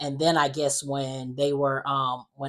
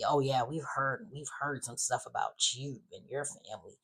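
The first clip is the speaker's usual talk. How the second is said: snide imitation; 2 semitones lower, 11 dB quieter than usual. Charlie greeting the newcomer, snide and quiet. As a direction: imitate a snide aside, dropping the volume. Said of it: "making snide comments".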